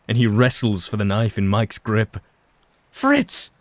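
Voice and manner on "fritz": high startled yell of a name; disbelieving yell. A narrator's reading. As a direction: shout his name in disbelief. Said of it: "Clara yells in disbelief".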